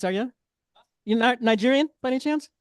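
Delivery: usual speaking voice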